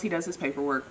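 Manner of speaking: ordinary talk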